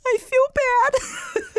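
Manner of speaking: hammed-up sob of the remorseful castaway; mock crying. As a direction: whine it through fake tears.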